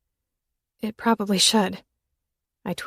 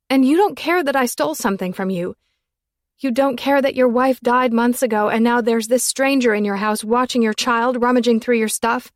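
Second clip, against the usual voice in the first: higher, louder accusing rant; upset raised voice; heated confrontation; 4 semitones higher, 6 dB louder than usual. A narrator's reading. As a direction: throw every point at him. Confronting Nate, rising upset.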